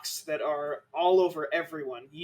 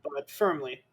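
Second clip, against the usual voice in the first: ordinary talk, nothing marked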